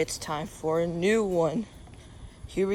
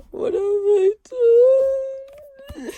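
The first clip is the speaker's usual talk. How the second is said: high wailing what have I done; mock despair; 10 dB louder than usual. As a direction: ham up a tragic wail.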